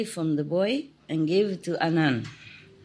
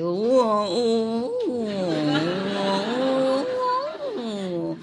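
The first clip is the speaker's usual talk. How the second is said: imitating dog's crying talk; 5 semitones higher, much slower than usual. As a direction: wail like a sad dog lamenting. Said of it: "it's not like barking".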